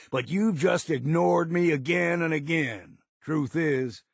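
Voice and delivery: ordinary talk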